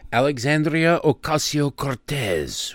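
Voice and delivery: Alexandria Ocasio-Cortez with rolled, mock Spanish vowels, lightly done; mock Spanish pronunciation. Host jokingly saying the congresswoman's name her way, mock Spanish accent. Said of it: "you have to say her name like that".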